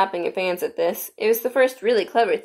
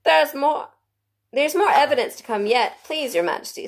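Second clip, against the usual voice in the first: high, hurried rabbit; 8 semitones higher than usual. A narrator's reading. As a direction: squeak out like a flustered rabbit.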